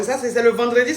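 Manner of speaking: normal talking voice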